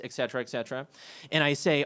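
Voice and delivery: ordinary delivery, nothing marked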